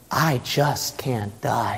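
low, soft, slow declaration; quiet resolve. Acted. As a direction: say it slowly and quietly, unshakeable. Inward man's answer.

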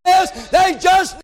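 high, driving preacher's cadence; full-force preaching. Acted. Preacher telling how blind men cried out, full force. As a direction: drive it up to a long, ringing peak.

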